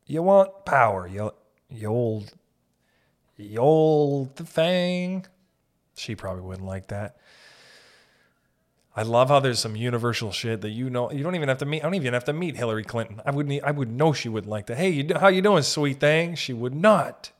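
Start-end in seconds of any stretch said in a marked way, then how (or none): 0.0-5.2 high, slow, louder flirting; flirty southern charmer. Retold or imitated. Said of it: "I would have just thought that". 14.8-16.4 higher, louder, sweet-talking; southern flirt. Retold or imitated. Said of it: "she's from the South".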